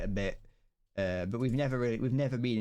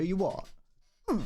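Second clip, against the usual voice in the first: higher brash chav "You what?"; mock chav at the shop; 7 semitones higher than usual. Mocking a chav at the corner shop, brash. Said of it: "you go".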